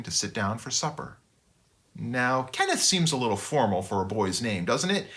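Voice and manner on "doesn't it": ordinary delivery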